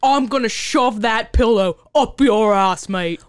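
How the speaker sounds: loud, slow mock-British threat; exaggerated British accent. Sister threatens sister over insults, loud hammy British accent. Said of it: "do this offensive British accent".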